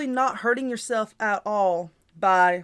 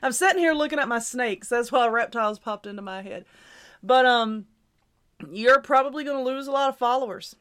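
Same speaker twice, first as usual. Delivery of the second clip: usual speaking voice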